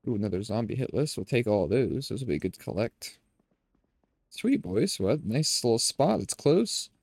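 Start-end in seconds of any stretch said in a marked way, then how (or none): none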